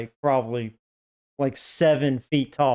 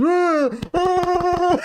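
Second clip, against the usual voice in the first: mock roar; 13 semitones higher than usual. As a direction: roar twice like King Kong. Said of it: "He goes".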